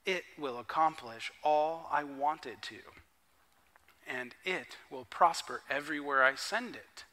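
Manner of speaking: preacher quietly reading Isaiah; scripture reading. Acted. Reads Isaiah passage.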